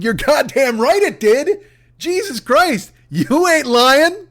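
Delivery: high, slow, drawn-out exasperation; answering the crash message with high, slow sarcasm; exasperated. Retold or imitated. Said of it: "I'm like".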